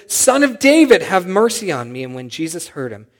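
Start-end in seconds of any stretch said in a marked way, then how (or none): 0.1-2.0 high, loud plea, falling off at the end; louder retold shout. Blind beggar calling again past those hushing him, louder retold shout. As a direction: imitate a beggar who refuses to be silenced. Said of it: "He shouted louder".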